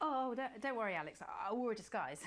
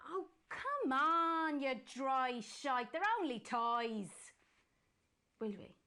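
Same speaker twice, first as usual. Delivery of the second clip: drawn-out teasing coax; cajoling; 5 semitones higher, 4 dB louder, much slower than usual. Acted. Presenter coaxing Jenny out for a drink. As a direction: wheedle her into the pub.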